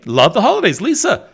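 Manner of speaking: ordinary talk, nothing marked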